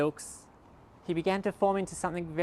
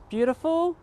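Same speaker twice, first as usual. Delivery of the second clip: grand reveal; 3 dB louder, slower than usual. Acted. Magic transformation reveal.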